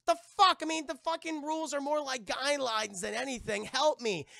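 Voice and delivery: high, loud indignant woman's voice; mock outraged Elizabeth. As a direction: squawk it like an outraged heroine.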